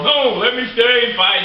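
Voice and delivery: a high, pleading plea to keep fighting; begging to stay in the ring; pleading wrestler. Retold or imitated. Injured wrestler on a stretcher begging to stay, high and pleading. Bryan voiced refusing to leave.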